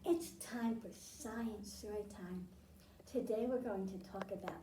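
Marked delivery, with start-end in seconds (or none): none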